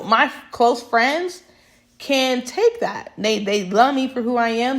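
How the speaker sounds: usual speaking voice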